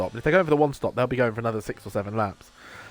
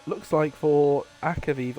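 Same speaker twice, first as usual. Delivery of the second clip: normal talking voice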